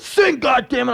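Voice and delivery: high, sudden angry yell; angry dad order. Retold or imitated. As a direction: imitate a father snapping an order. Dad orders embarrassed boy to sing.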